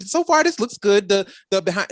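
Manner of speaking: ordinary talk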